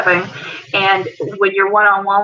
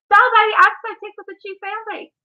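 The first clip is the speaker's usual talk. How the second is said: high-pitched, gushing Southern delight; delighted greeting; 11 semitones higher than usual. Real, not acted. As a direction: greet a found friend with sweet, high delight.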